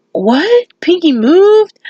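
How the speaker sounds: high, rising disbelief; acted-out startled question; shocked reaction. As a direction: voice leaps up in astonishment. Friends react to news in retelling.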